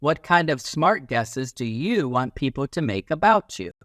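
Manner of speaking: plain reading voice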